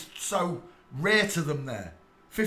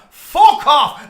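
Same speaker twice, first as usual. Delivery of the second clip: loud higher furious outburst; angry shout; 11 semitones higher, 16 dB louder than usual. Real, not acted. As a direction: bark it at him in fury. Ranting at commenter.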